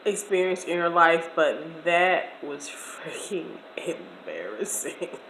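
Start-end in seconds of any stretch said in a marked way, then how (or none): 1.8-5.2 slow hushed whisper of shame. Real, not acted. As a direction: drop to a whisper, drawn out. Confessing embarrassment.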